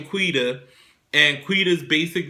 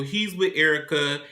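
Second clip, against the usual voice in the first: ordinary talk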